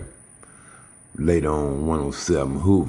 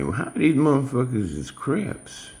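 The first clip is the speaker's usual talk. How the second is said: much higher-pitched, baffled disbelief; incredulous question; 12 semitones higher than usual. Retold or imitated. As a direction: question it like it makes no sense. Retelling his baffled reaction to posts.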